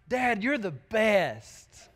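slow, drawn-out heartfelt thanks; child's tender praise. Retold or imitated. Son thanking his dad after being let go play, slow and tender. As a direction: stretch it like a grateful little boy. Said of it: "He said".

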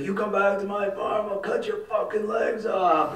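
high, slowing, enraged threat; threatening farmer. Retold or imitated. Crazy farmer threatening the trespassers, high furious yell. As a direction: snarl the threat at the intruders. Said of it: "he's like".

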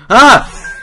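very high, loud shriek; startled scream. Real, not acted. Player scared by the face, startled shriek. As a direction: scream in sudden fright.

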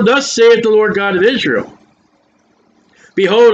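ordinary talk

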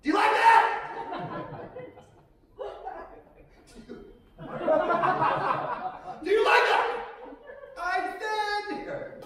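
very high, strained call to someone far above; man with giraffe. Acted. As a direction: call up high to a towering partner. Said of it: "My impression of me having sex with a giraffe".

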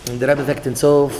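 usual speaking voice

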